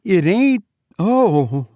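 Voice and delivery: high-pitched, startled woman; frightened wife's exclamation; alarmed wife. A narrator's reading. Narrator voices the alarmed wife.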